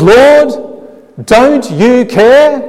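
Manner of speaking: high, loud, slow accusing cry of Martha; Martha's accusing cry. Retold or imitated. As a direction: voice Martha's hurt accusation, drawn out. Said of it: "She says".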